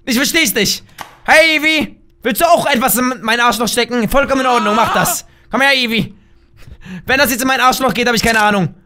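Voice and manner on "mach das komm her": usual speaking voice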